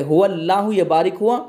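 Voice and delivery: ordinary delivery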